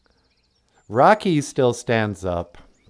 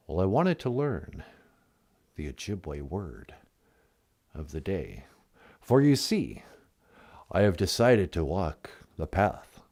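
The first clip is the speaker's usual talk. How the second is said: a mock-solemn caller named Tonto; low solemn Tonto voice; 8 semitones lower, 9 dB quieter than usual.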